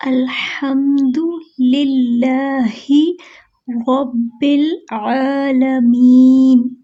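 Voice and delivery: slow, drawn-out tajweed chanting; Quran recitation. Shown as a demonstration. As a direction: recite with measured melody. (धीमी तजवीद वाली तिलावत)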